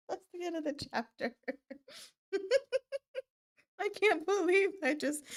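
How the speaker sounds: high, fast, laughing; can't stop giggling. Real, not acted. Giggling after reading blunder.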